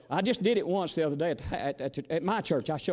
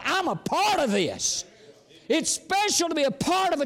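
fervent pulpit preaching, rising and falling; 10 semitones higher, 4 dB louder than usual. Acted. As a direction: preach it with full force to the congregation. Preacher in full flow.

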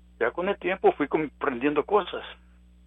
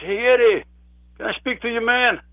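much higher, louder call of Eddie's name; neighbor calling out; 9 semitones higher, 5 dB louder than usual. Retold or imitated. Neighbor across the street calling to Eddie, raised friendly call.

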